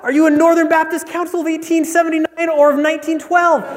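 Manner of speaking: hammed-up excited joke dialogue; mounting excitement. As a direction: build to a giddy peak as each match is found. Escalating exchange in a joke.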